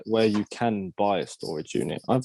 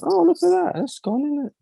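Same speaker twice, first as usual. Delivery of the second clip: much higher mock onlooker voice; onlookers' reaction. Imagined viewers reacting to a short video, high-pitched mock interest. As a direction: squeal it like a passing viewer.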